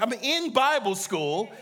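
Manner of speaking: high, disbelieving protest; can't believe what he's hearing; mock panic, laughing at himself; incredulous. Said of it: "I'm like".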